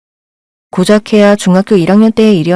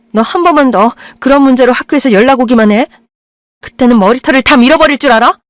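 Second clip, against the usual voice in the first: angry threat; 4 semitones higher than usual. Acted. Mother threatens daughter.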